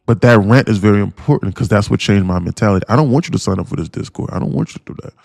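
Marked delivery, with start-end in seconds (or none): 4.3-5.1 breathy whisper aside. Real, not acted. Sudden aside, unvoiced.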